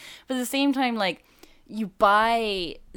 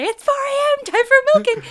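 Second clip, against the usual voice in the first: very high, loud, hammed-up holler; mock wake-up yell in usual accent; 12 dB louder than usual. Host playing a prankster waking someone at four, mock yell. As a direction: yell it like a gleeful early alarm. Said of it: "you have to say it in, like, a slight German accent".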